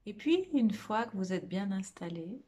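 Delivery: guided meditation. Real, not acted. Meditation teacher guiding listeners through a body scan, soft guided meditation. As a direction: lead them gently inward, phrase by phrase, leaving lots of space.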